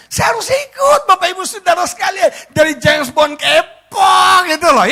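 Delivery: very high, loud comic wail; hammed-up put-upon husband; exasperated protest. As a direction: whine loudly to the whole crowd. Husband protests tagging along with women.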